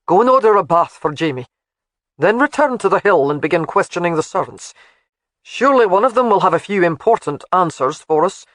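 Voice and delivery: firm, lightly voiced laird giving orders; laird's voice. Narrator voices Alec commanding.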